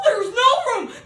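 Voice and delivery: high, loud wailing shout; cried out. Acted. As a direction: wail the refusal at her. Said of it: "they cried out".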